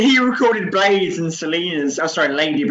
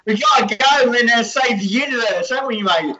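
put-on a higher New Zealand accent with laughter breaking through; 6 semitones higher than usual. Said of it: "this is me trying to do a New Zealand accent".

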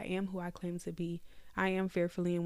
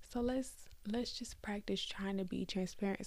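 softer, whispered invitation; 5 dB quieter than usual. Real, not acted. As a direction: whisper the encouragement to them. Gentle urging to listeners.

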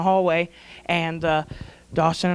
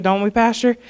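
ordinary delivery

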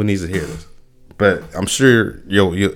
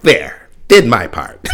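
slow, smug little voice; drawn-out pleased-with-myself quip; smug self-satisfied; 4 dB louder, much slower than usual. Retold or imitated. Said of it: "I'm like".

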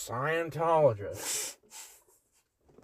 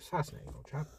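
mock British accent; 11 dB quieter, much faster than usual. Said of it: "problem with my accent".

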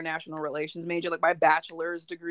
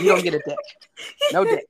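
laughing; 5 dB louder, faster than usual. Pretend. Laughing mock verdict.

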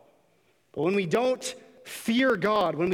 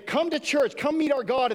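much higher, bright selling pitch; mock cheery invitation; 9 semitones higher, 3 dB louder than usual. Church inviting the world in, eager and higher. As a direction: sell it like a cheerful recruiter.

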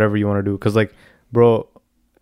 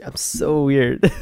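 slow, higher teasing mimicry; mocking imitation; 4 semitones higher, much slower than usual. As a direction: drag it out to mock your friend. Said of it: "make fun of me for calling myself weird".

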